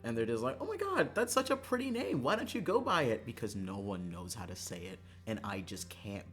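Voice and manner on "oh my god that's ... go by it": very high, delighted squeal easing down; imitated gushing. People admiring the real name, mock gushing.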